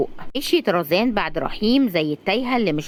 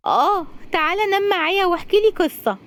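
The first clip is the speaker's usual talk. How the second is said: put-on child voice; 11 semitones higher than usual. Child asking for story.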